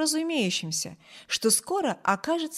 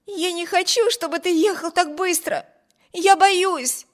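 frightened plea; 8 semitones higher, 4 dB louder than usual. A narrator's reading. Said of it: "воскликнула Эми".

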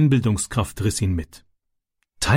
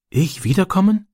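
timid, high-pitched question; shy; 8 semitones higher than usual. A narrator's reading. Boy asks his friend whether he may return, shyly. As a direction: ask it shyly, voice lifting high. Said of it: "fragte er schüchtern".

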